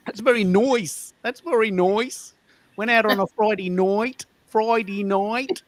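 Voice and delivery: high, slow, drawn-out Melbourne vowels; exaggerated Melbourne, "nice" as "noise", "night" as "noit"; Melbourne accent. Shown as a demonstration. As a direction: stretch each last word in broad Melbourne. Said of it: "that's the Melbourne accent".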